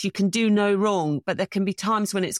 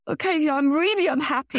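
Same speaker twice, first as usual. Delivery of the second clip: self-pitying thought; 9 semitones higher than usual. Retold or imitated. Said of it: "I thought to myself".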